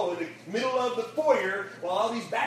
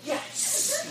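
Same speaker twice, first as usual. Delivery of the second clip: much higher, victorious whoop; triumphant cheer. Real, not acted. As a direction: celebrate like your team scored. Pastor cheering at the kid's reply.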